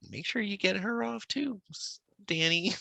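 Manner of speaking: grandma voice. Retold or imitated. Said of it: "she'd probably be like".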